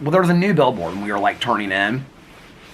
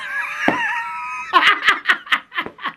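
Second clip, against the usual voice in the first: very high, squealing laugh; shrieking laugh. Real, not acted. Laughing at her own comeback.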